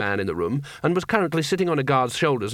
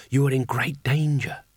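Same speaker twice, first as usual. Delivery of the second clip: seductive woman's voice. A narrator's reading. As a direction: draw the urgent words out invitingly. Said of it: "a voice that could make "good morning" sound like an invitation".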